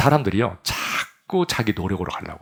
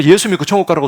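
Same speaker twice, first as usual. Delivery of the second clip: loud, much higher pulpit cry; preacher shouting to the congregation, loud and high; 9 semitones higher, 9 dB louder than usual. Acted. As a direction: shout it out with full force. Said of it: "소리를 지르면".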